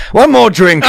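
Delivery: high, cheery mock drunk voice; imitating drunk Jim. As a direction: lightly ham up the drunk friend. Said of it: "Jim goes".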